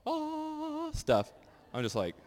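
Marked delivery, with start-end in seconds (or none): 0.0-0.9 high, drawn-out mock "Ah" of a showy worship singer; mock worship moan. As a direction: wail it high like a hammed-up worship leader. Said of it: "I'm doing none of the, like, ... stuff".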